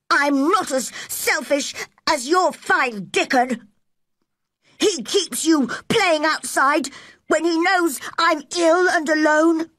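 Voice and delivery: high-pitched querulous complaint; aggrieved boy. Acted. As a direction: complain like a wronged child, high. Colin complains in quarrel.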